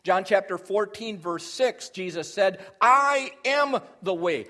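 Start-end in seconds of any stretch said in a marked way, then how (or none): 2.8-4.5 "I am" drawn out loud and slow, stress on "I"; ringing, weighty stress on "I"; emphatic I. Shown as a demonstration. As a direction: punch "I" and stretch "I am". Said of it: "It's very emphatic".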